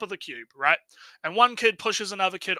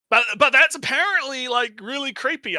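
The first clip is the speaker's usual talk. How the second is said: mock outrage; 7 semitones higher, 6 dB louder than usual. Real, not acted.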